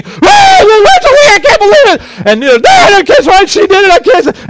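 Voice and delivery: put-on loud, very high wailing kids; screaming kids. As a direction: scream it like upset children. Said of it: "kids screaming at the top of their lungs".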